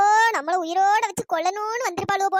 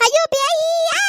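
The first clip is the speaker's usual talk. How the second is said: scared scream; 8 semitones higher, 6 dB louder, slower than usual. Acted. Seeing a ghost, screams.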